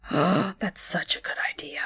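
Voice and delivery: gasped, slow, breathless whisper. Real, not acted. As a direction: whisper it in awe. Gasp then hushed delight.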